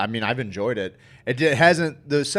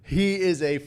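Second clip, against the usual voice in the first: slow, word-by-word emphasis; deliberate, drawn-out put-down; slow emphatic verdict; much slower than usual. Real, not acted. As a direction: draw out each word for weight.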